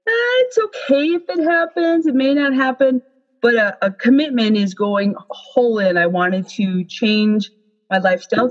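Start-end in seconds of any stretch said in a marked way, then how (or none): 0.1-3.0 high, offhand "eh, whatever" voice; careless shrug voice. Retold or imitated. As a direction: toss it off lightly, not caring. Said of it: "a goal is something like".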